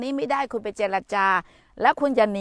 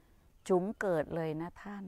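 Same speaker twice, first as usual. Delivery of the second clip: drawn out; 2 semitones lower, 6 dB quieter, slower than usual. Retold or imitated. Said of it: "ป้าตุ๋มพูดว่า".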